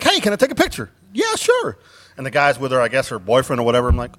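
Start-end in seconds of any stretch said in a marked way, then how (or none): 0.0-0.9 high-pitched, eager retold question; perky, raised-pitch ask; eager request. Retelling his eager photo request. 1.1-1.8 very high, chirpy put-on voice; mimicking her in a tiny high voice; imitated woman's reply. Voicing the costumed woman's reply.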